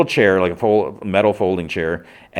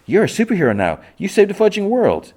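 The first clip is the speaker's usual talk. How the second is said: excited praise; 13 semitones higher, 3 dB louder than usual. Retold or imitated. Girlfriend hyping him up.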